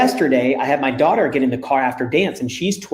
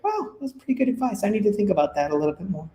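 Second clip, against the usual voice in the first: higher but softer, rushed, hammed-up amazement; mock self-impressed; 5 semitones higher, 7 dB quieter, faster than usual. Dad mocking his own surprisingly good advice, hammed-up awe. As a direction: play it as a joke on yourself being impressed.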